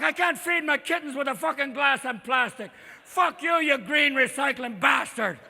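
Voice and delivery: put-on loud, high Irish-accented rant; hammed-up Irish outrage; angry Irish raccoon. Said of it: "I made the raccoons Irish".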